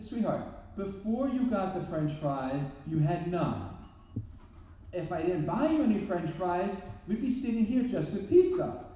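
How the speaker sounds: high, slow, exaggerated exasperation; mock exasperated lecture. Father lecturing daughter over one fry, hammed-up exasperation. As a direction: lay it on thick like a wounded dad.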